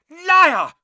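very high, explosive yell; sudden shout. A narrator's reading. Harry defying his parents' killer, shouting. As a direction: burst out loud and high. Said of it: "Harry shouted suddenly".

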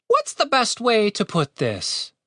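high, slow bird voice; high-pitched, drawn-out musing; pondering bird. A narrator's reading. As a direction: think aloud slowly as a high-voiced bird. Bird ponders how to explain.

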